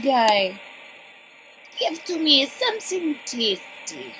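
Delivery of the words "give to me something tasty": a hint of a higher, odd bug-creature voice; cockroach guy voice. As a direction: lightly voice a bug-eating creature. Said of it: "that's a good voice for him".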